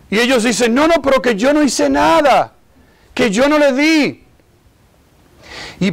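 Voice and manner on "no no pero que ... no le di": higher-pitched mock childish denial; protesting children. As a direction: deny it like an indignant kid, pitched up. Acted children keep denying blame. (niños negando con voz aguda)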